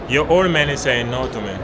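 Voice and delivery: ordinary talk, nothing marked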